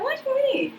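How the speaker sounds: bewildered, voice jumping up; retold confusion. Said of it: "I was like".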